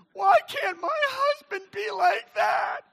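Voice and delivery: loud, very high, slow blubbering; mock wailing. Women watching lament their husbands, mock-sobbing. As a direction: bawl it out as a hammed-up weeping wife. Mimics women weeping at the movie.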